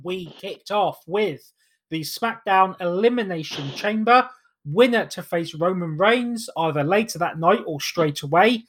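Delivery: ordinary talk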